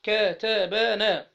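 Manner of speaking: very slow, drawn-out كتبتي, every syllable stretched; slow demo. Shown as a demonstration. Teacher slowly modelling كتبتي for the class. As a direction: dwell on each syllable of كتبتي. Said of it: "ونون النسوة بتبقى مفتوحة".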